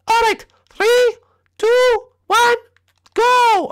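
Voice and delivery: very high cartoon announcer voice; high-pitched official countdown; squeaky announcer. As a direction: count down in a tiny, piercing voice. High figure counts down round.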